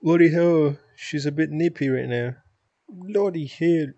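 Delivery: attempted Australian accent. Streamer trying accents for chat, off-target Australian accent. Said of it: "that's Australian".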